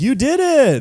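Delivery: high, loud cheer of congratulation; hammed-up excited buddy cheering. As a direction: shout it like he just won the game. Hunters waking Elliot, cheering.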